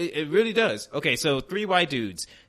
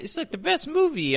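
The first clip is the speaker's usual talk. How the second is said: mock wife gushing. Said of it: "Alton's wife says".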